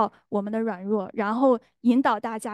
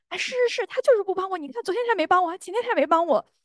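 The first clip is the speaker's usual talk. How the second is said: much higher, quick indignant grumble; annoyed agreeing; 9 semitones higher, faster than usual. Retold or imitated. Wife fuming to herself about her husband, high indignant rush. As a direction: pile up the grievances, fast and high. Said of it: "我就很生气".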